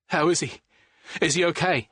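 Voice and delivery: desperate for news; anxious rush. Acted. To the doctor about Jules, anxious and high.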